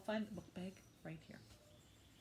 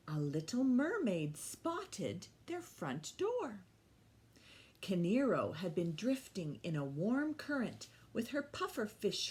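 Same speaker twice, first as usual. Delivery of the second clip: loud, lively read-aloud voice; story reading to children; 8 dB louder, slower than usual. Acted. Teacher reading The Mermaid to the class, lively storytelling. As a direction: read it big for the little ones. Reads picture book aloud.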